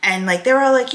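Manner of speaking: ordinary delivery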